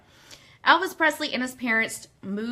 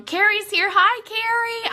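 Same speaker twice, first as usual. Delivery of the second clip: excited greeting; 10 semitones higher, 5 dB louder than usual. Real, not acted. Host greeting friend Carrie in the chat, delighted.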